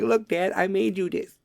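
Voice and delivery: put-on high, eager little kid. A child showing dad a drawing, high and eager. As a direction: pipe up like a proud child. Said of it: "And you're like".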